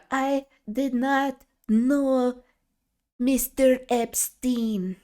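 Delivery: put-on high, very slow accented parody; mock foreign-accented First Lady voice; Melania Trump. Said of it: "That's my Melania impression".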